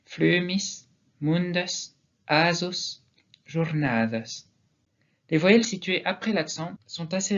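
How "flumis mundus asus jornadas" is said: "flumis", "mundus", "asus", "jornadas" stressed before the last syllable; penultimate stress. Shown as a demonstration. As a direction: lengthen the accented vowel, slowing on "jornadas". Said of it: "quand la dernière voyelle est suivie d'un S".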